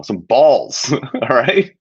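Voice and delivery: ordinary talk, nothing marked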